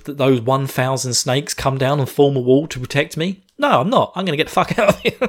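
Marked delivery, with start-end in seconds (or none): 3.6-5.2 higher, fast, laughing retort; mock defiant exclaim. Retelling Ali's quote to the listeners, fast laughing defiance.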